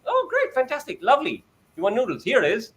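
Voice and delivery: put-on shower the child with delighted praise; a parent rewarding the son's first sentence; overjoyed parent.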